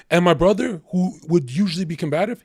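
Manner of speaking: ordinary talk, nothing marked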